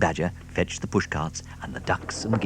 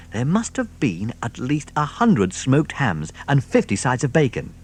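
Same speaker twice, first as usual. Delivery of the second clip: ordinary talk, nothing marked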